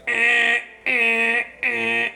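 high loud mock baby cry; mock baby wail. Joking about a loud baby, mock newborn wail.

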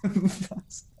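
high, laughing "what?"; laughing disbelief. Real, not acted. Host reacting to the story, laughing in disbelief. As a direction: burst out laughing into the word.

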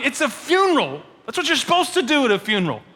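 high, exasperated disbelief; incredulous mourners. Retold or imitated. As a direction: explain the obvious, offended. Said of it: "they're going".

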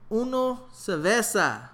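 slow, drawn-out repeat of "Uno cerveza". Retold or imitated. To the bartender who did not hear, slow repeat. Repeating order louder, slower.

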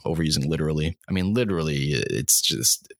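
usual speaking voice

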